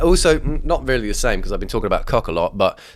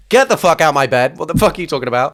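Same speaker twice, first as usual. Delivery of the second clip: higher, louder mock-angry outburst; acted outrage; 5 semitones higher, 5 dB louder than usual. Woken in bed at three A M, acted outrage. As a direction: throw the intruder out in mock fury.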